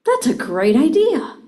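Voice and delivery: bright, loud and high "That's a great idea."; enthusiastic. Shown as a demonstration. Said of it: "I can say".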